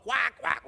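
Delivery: imitation duck quacks; mock duck quack. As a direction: quack it out mockingly. Mocks clerk as a duck.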